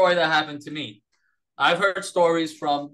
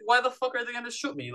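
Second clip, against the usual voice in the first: incredulous; 10 semitones higher, faster than usual. Retold or imitated. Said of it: "I was like".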